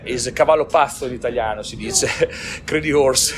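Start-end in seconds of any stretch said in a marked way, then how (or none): 2.6-3.4 real laughter through it; laughing at the nickname crazy horse. Real, not acted. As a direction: laugh at the translation. Translates nickname, breaks into laughter.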